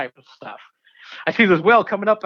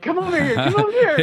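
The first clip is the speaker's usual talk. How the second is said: high playful call, as if the whale beckons; voicing the whale; 3 dB louder than usual. Diver voicing the whale calling the swimmers over, high playful call. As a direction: sing it out high like a friendly whale.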